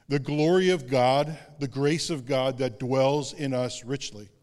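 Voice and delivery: ordinary talk, nothing marked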